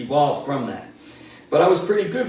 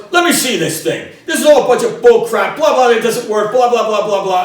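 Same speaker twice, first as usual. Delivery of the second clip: higher, much louder scoffing father daring his son; angry dad challenge; 8 semitones higher, 10 dB louder than usual. Retold or imitated. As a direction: bark it like a disbelieving dad. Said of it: "He goes".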